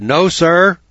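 loud, high No, sir. Retold or imitated. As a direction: bark it out so the judge hears. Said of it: "so I raised my voice and I said".

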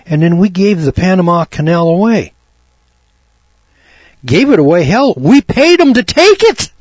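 high, loud outraged preaching; indignant preaching. Acted. Preacher rails at the congregation over the Panama Canal, outraged and loud. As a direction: let the outrage climb.

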